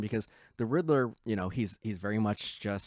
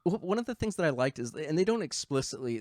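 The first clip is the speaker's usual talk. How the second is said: usual speaking voice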